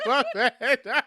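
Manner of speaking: high, laughing apology; laughing through the apology. Real, not acted.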